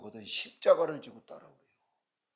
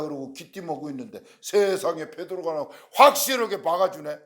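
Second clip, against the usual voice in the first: ordinary talk, nothing marked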